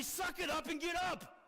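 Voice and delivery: father ordering his hurt son to get up; gruff dad order. Retold or imitated. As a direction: yell it at the crying kid. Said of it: "my dad kept telling me".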